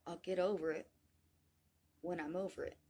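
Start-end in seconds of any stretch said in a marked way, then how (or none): none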